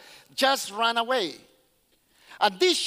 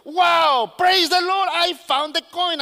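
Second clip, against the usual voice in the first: high, loud jubilant praise; overjoyed; 5 semitones higher, 6 dB louder, slower than usual. Acted. Woman praising the Lord on finding her coin, overjoyed. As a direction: shout it out, bursting with joy. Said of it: "she joy, overjoyed".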